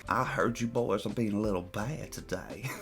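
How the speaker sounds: put-on Southern accent. Repeating Karen's line in a hushed Southern accent. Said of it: "Why do you have a little Southern accent?".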